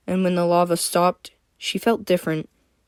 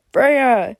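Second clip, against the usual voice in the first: loud, higher call of the name; gurgled shout; 6 semitones higher, 5 dB louder than usual. A narrator's reading. Voice calling to Freya underwater, gurgled shout. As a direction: call out loudly through water. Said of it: "a shouting voice gurgled".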